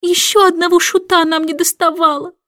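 high exasperated queen; queen lamenting another jester, pitched high; queen's exasperation. Acted. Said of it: "вскричала королева".

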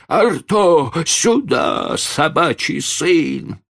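put-on high, loud, cross old falsetto; angry old man's falsetto. Old man calling his dog, angry high falsetto. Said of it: "сердитым, сиплым со сна старческим фальцетом".

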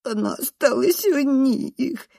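high, slow, whiny wail; whimpering. A narrator's reading. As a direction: whimper it like a cornered child. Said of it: "хнычет Ренат". (хнычет, растягивая слова)

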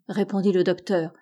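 plain reading voice

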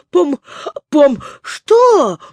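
high, loud, sputtering yell for help; choking cry for help. Acted. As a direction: gasp it out like drowning. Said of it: "кричал он, захлёбываясь". (крик о помощи, захлёбываясь)

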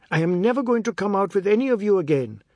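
furious. Acted. Boy dressing furiously, leaving.

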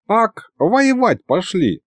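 put-on high, slow old man's remark; lightly voiced, wry old man; amused grandfather. A narrator's reading. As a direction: drawl it like a scoffing grandpa. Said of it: "хмыкнул дед".

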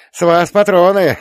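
put-on loud high cheerful old man; gleeful old man. A narrator's reading. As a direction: crow it out with glee. Cheerful old man after fight. (ликующий старик)

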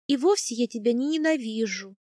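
plain reading voice

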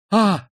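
sharp, high, loud cry of realization; a sudden cry. A narrator's reading. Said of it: "вскрикнула она вдруг".

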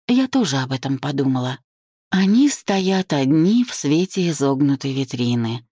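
ordinary delivery, nothing marked

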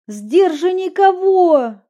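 high, loud, slow outraged question; indignant cry. Acted. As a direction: fire it back in disbelief. Indignant retort, cries from pain.

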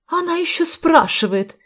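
high-pitched indignant outburst; angry. A narrator's reading. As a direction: scoff in angry outrage, voice well up. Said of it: "разозлилась женщина". (возмущённо, высоким голосом)

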